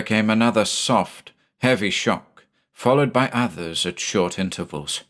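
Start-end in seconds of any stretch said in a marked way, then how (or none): none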